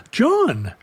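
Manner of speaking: high startled name; surprised "John". Retold or imitated. Coworker surprised.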